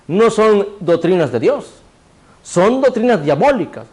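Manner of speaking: normal talking voice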